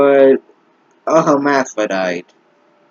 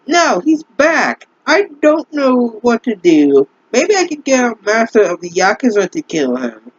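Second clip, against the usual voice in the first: mock anguished girl; 9 semitones higher than usual. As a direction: ham up the distraught heroine in a high pitch. Reading the girl's fanfic lines.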